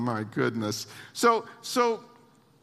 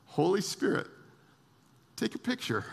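usual speaking voice

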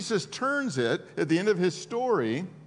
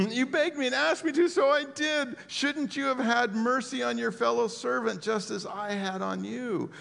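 stern rebuke; 7 semitones higher than usual. Acted. Master rebukes the unforgiving servant, high stern scolding. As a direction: scold him for showing no mercy.

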